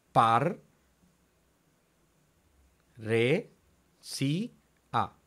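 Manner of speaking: "Par, re, si, a" with long pauses between syllables. Shown as a demonstration. Teacher showing how to divide, "Par, re, si, a" syllable by syllable. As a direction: isolate each piece of "Par, re, si, a". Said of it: "dividimos de esa manera".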